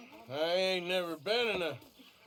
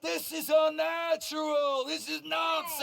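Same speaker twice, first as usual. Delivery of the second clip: angry heckle; 6 semitones higher than usual. Acted. Heckler shouting at the speaker.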